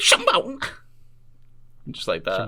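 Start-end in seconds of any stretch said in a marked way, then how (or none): 0.0-0.6 high yelped pop-star ad-lib. Acted. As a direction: punch it out high like a dubstep drop. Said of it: "is he doing the dubstep noises?".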